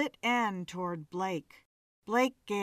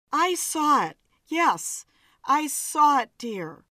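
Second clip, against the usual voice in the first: higher and louder reassurance; raised soothing; 4 semitones higher, 5 dB louder than usual. Acted. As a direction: reassure him over his panic. Joan soothing shaken husband.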